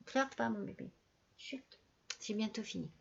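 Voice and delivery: soft hushing to a pet; whispering over a crib. Real, not acted. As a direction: gently shush the dog mid-sentence. Hushing her impatient dog.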